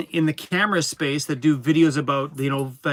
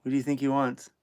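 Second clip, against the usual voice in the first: soft teasing coo; 7 dB quieter than usual. Real, not acted. Owner softly coaxing the whining dog. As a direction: hush and tease the whining dog.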